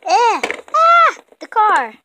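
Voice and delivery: very high, loud yell; strained yell. Acted. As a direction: burst out loud. Strained outburst.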